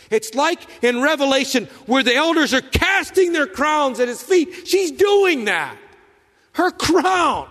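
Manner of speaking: high, loud, slowing to a shouted crown; preacher's shouted climax; preaching climax. Acted. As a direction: cry out the last words. Climax of sermon point.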